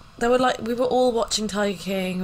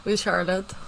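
ordinary talk, nothing marked

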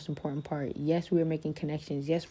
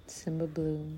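ordinary talk

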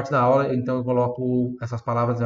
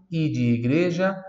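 normal talking voice

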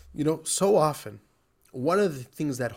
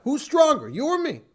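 put-on higher-pitched challenge with a light Russian accent; slight Russian accent, voice raised; 10 semitones higher, 4 dB louder than usual. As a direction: imitate a Russian father daring his cigarette, pitched up. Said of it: "in a Russian accent, he would say".

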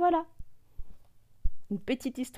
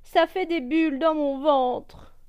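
whiny; 6 semitones higher, 4 dB louder than usual. Acted. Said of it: "se plaint le perroquet".

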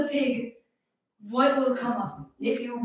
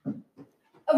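ordinary talk, nothing marked

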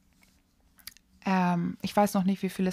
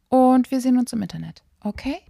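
normal talking voice

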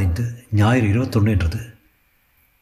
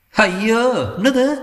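startled; 13 semitones higher, much slower than usual. Acted. Vasanth startled by sudden rush.

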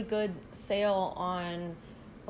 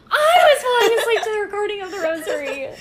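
squealing high through laughter; laughing shout; 12 semitones higher, 13 dB louder than usual. Retold or imitated. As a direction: shriek it while cracking up. Same quote repeated, laughing shout.

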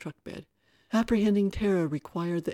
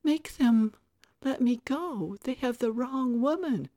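put-on high-pitched childish begging; pleading little-girl voice; 7 semitones higher, 4 dB louder than usual. A narrator's reading. Tara begging Mike to stop the officers, pleading little-girl voice. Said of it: "who was pleading in a little girl voice".

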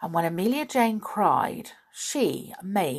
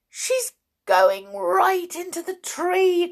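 higher, slow urgent teddy bear cry; excited cry; 11 semitones higher, 5 dB louder than usual. A narrator's reading. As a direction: call out in alarm, slowly. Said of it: "cried the teddy bear".

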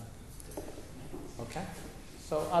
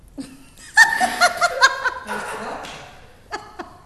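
loud, high, open-mouthed burst of laughter; big hearty laugh; 10 dB louder than usual. Shown as a demonstration. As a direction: let out a full belly laugh for the group. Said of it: "you're gonna make".

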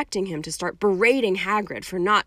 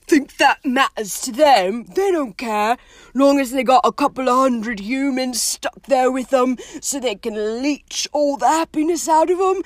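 loud, heated contempt, pitched up; bitter, scornful gamekeeper; 5 semitones higher, 6 dB louder than usual. Acted. Hagrid telling Hermione Dementors don't care, bitter. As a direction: spit out the grievance loudly.

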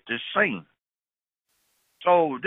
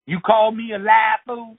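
high, pushy street tough calling out; high-pitched tough hassling a stranger; 10 semitones higher, 3 dB louder than usual. Retold or imitated. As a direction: needle the guy at the bus stop, voice pitched way up. Tough accusing the man.

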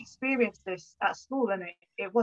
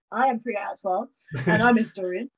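ordinary talk, nothing marked

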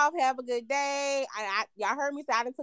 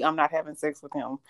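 ordinary talk

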